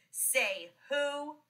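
high, drawn-out storybook howl; mildly howled challenge; howled question. A narrator's reading. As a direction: howl it as the playground bully. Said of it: "she howled".

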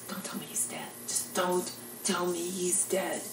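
bracing for the worst, barely voiced; hushed dread. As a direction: plead under your breath, slowing right down. Bill dreading bad news, acted out.

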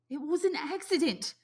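put-on high boy's voice, flat insistence; stubborn. A narrator's reading. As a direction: dig in your heels. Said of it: "said Piggy stubbornly".

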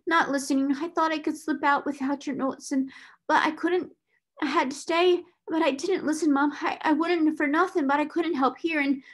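put-on high-pitched, halting servant girl's excuses; anxious protest. A narrator's reading. Scullery maid denying she eavesdropped, anxiously protesting. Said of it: "Becky protested".

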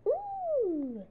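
high delighted gasp; high, thrilled ooh; excited ooh. Acted. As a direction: spot the treat with glee. Pigeon spots hot dog.

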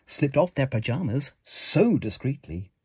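usual speaking voice

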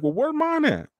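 high whining where's mine; jealous whine. Retold or imitated. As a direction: pitch it way up like a jealous friend. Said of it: "You're like".

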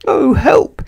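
tiny, very high squeak for help; frightened hush. A narrator's reading. Mister Jelly spotting a bear in camp, frightened squeak. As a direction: squeak it so the bear won't hear. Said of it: "he whispered very quietly".

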